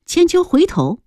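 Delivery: usual speaking voice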